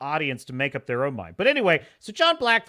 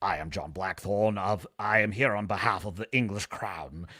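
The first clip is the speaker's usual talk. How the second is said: lower, softer, pompous formal sailor voice; polite Englishman; 9 semitones lower, 5 dB quieter than usual. Retold or imitated. Blackthorne addressing the samurai, lowered mock-formal voice. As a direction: play the stiff courteous envoy. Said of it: "He's like".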